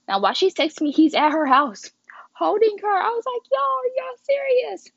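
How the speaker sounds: rising disbelief. Real, not acted. Telling viewers he was holding her, climbing into high shocked disbelief. Outraged retelling of boyfriend's betrayal.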